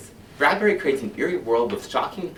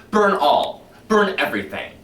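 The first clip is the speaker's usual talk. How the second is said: higher and louder fanatical cry; shouting "Burn everything" higher and louder; rising shout; 8 semitones higher, 7 dB louder than usual. Acted. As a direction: whip up the crowd to burn. Escalating burn commands.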